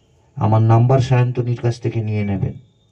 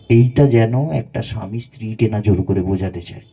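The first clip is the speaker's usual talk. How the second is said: ordinary talk, nothing marked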